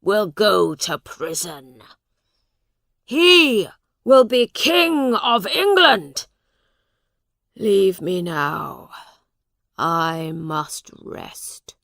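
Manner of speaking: slow, laboured voice of an ailing king; weary king. Acted. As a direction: proclaim it, then fade slowly into exhaustion. Voices the sick king's speech.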